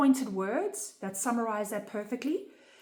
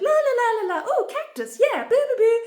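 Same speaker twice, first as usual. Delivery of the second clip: high, loud, giggly singsong of a playing child; joyful nonsense singsong; childlike play; 13 semitones higher, 10 dB louder than usual. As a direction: babble like a carefree little kid painting. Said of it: "I was just like".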